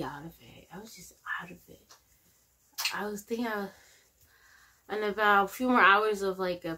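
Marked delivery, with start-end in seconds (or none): 0.0-1.9 hushed, breathless whisper; drained, barely-there whisper. Real, not acted. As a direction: whisper it like you're fading out. Describing being barely conscious.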